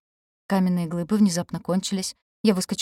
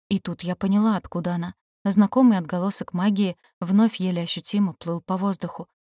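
ordinary delivery, nothing marked

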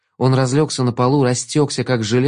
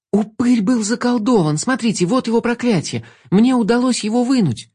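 high, eager explaining; eager to prove it; excited; 11 semitones higher than usual. A narrator's reading.

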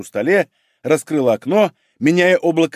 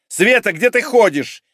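slow, higher bark at the doorway; sudden bark; 8 semitones higher, 3 dB louder, slower than usual. A narrator's reading. Head doctor suddenly barks at Sveta in the doorway. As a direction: snap at Sveta the moment she appears. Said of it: "неожиданно рявкнула она".

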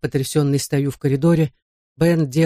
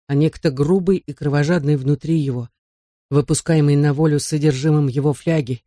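ordinary delivery

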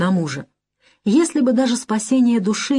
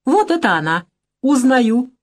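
playful cry; 5 semitones higher than usual. A narrator's reading. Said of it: "игриво крикнул Лизагуб".

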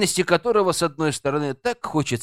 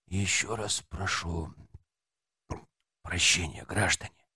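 soft, low apology; quiet; 9 semitones lower, 10 dB quieter than usual. A narrator's reading. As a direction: say it softly, withdrawn. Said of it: "тихо сказал милиционер".

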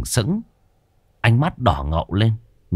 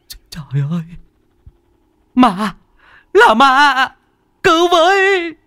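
terrified shriek; 5 dB louder than usual. A narrator's reading. Boy spots the demon and cries for help, terrified shrieking. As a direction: gasp of dread rising into high, loud screams. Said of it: "Nó rú lên một tiếng".

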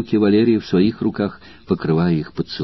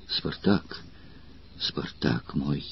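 breathy whispered name, slowing on мой; tender whisper; 5 semitones lower, 14 dB quieter, much slower than usual. Acted. Valeria whispers tenderly to Spartacus. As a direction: call his name softly, almost unvoiced.

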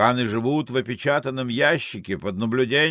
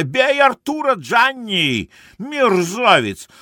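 high, loud, furious woman; angry egging-on; 10 semitones higher, 4 dB louder, slower than usual. Acted. Beauty urges Gianni against Arthur, high angry shout. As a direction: cry out for a beating.

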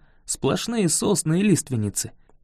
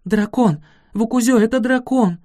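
alarmed cry; 6 semitones higher, 3 dB louder than usual. A narrator's reading. Girl recognizes a dragon.

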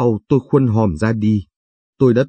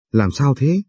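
a more anxious repeat in a higher voice; lightly voiced wife asking again, higher and more worried; anxious question; 4 semitones higher, slower than usual. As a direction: ask again, pitch raised with worry.

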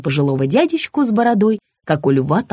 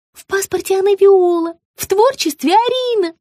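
high explaining gush; high sugary woman; 4 dB louder than usual. A narrator's reading. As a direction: explain sweetly. Margarita's voice.

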